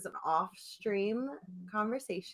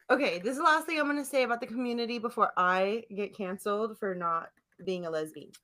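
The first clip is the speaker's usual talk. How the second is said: usual speaking voice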